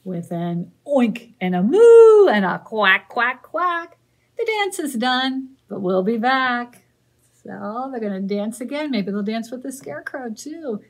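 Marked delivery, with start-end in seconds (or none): 0.0-6.8 louder closing refrain; loud sing-song finish; big refrain. Acted. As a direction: land the ending with a flourish. Final refrain.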